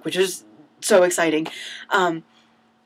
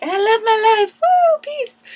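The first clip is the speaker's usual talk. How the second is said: much higher and louder joyful shouting; ecstatic yelling; 8 dB louder, slower than usual. Retold or imitated. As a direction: scream with joy like at a concert. Dancing onstage at curtain call.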